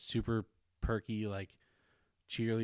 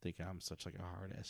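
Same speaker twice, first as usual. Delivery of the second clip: ordinary talk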